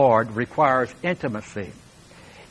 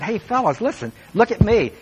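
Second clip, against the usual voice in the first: higher, friendly call to the group; calling disciples; 8 semitones higher than usual. Retold or imitated. Jesus calling his disciples to look at him, higher and bright. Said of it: "He said".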